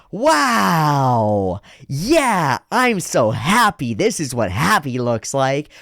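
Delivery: high, loud, drawn-out cartoon joy; big slow gushing delight, hammed up; exaggerated happy anime character. Anime character showing children an obvious emotion, hammed-up happy. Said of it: "anime character goes".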